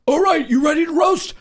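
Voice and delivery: high shouted emcee hype; imitating a shouting roast moderator; Brian Moses. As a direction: yell it like a battle host firing up a crowd. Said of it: "Brian Moses goes".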